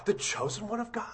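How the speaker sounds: wondering question. Shown as a demonstration. Preacher turning the taunt into a quiet question. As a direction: ponder the title quietly. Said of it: "repeats taunt as question".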